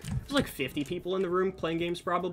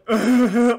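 loud, high burst of mock sobbing; hammed-up sobbing impression; imitated violent sobbing; 9 semitones higher, 12 dB louder than usual. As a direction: act out a violent, heaving bawl. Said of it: "that was my impression of it".

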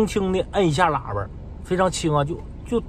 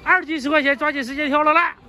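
high-pitched market patter; street vendor's sales call; 8 semitones higher than usual. Real, not acted. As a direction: call customers over to the stall.

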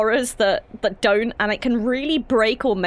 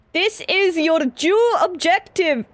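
higher, slow, badly faked Scottish; bad Scottish accent; 7 semitones higher, 4 dB louder, slower than usual. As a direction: attempt a drawn-out Scottish accent and botch it. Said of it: "I've never been good at accents".

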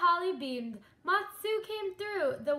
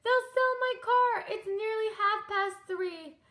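high, loud storybook wail; a higher, louder mock wail; 6 semitones higher, 3 dB louder than usual. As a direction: wail in dismay. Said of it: "Miss Spider wailed".

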